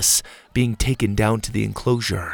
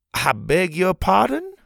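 higher, indignant question; shocked the boy talked back; taken aback; 9 semitones higher than usual. Acted. Pa answers Bo's challenge, indignant.

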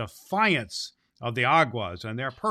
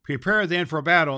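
higher, louder call to arms; rallying call; 5 semitones higher, 6 dB louder than usual. Acted. The master rallying the immortals to battle, loud and rousing. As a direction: rouse the troops for battle.

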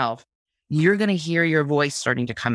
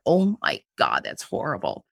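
cringing disgust; 5 semitones lower than usual. Retold or imitated. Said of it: "I cringe".

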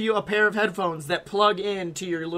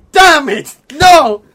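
loud imitating yell, much louder; retold screaming; 5 semitones higher, 13 dB louder, slower than usual. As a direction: scream it like a frustrated driver holding back swears. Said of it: "And he is screaming".

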